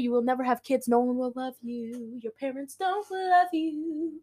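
mock-mournful singsong jeer; taunting. Depression taunts Maya in story.

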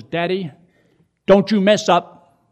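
imitating a daughter's high, loud telling-off; preachy scolding. Daughter warns her daddy over the phone, scolding. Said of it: "She preached to me".

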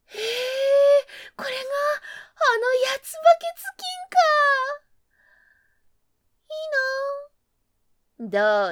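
loud, high envious gasp; envious fox gush. Acted.

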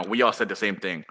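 ordinary talk, nothing marked

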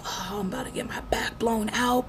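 unvoiced gleeful whisper of a secret. Telling coworkers she is about to see her man, whispered. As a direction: hush it like a naughty secret.

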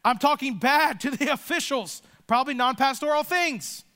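usual speaking voice

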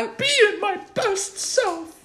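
high, slow, wobbling fake sob; fake crying. Parent still fake-crying at the daughter, high slow whimper. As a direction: whimper it like a pretend breakdown. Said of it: "I wish I could cry on cue".

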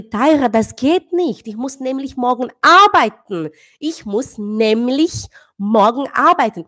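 put-on slow, deliberate German to a child; slow self-quote. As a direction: spell it out slowly for the daughter. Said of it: "Eu falei".

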